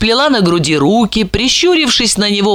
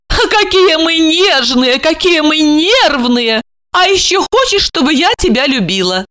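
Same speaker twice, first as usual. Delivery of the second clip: mocking; 9 semitones higher than usual. A narrator's reading. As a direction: tease his delicacy with fake pity. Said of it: "усмехнулась Ольга".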